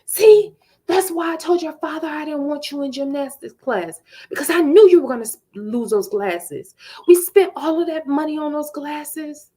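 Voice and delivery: high, loud scolding mother; angry mom. Retold or imitated. Mother scolding daughter over lost glasses, high and loud. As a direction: blast it like a furious mother.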